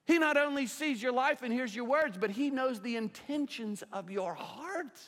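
high, emphatic sermon delivery; impassioned preaching. Real, not acted. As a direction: drive the warning home from the pulpit. Preaches at raised force.

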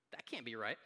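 low, soft disbelief; doubting listener. Acted. Listener reacting to Jesus, low sceptical aside. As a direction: voice a quiet, dropped-pitch doubt. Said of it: "you probably heard what Jesus said and you're like".

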